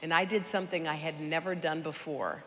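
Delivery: normal talking voice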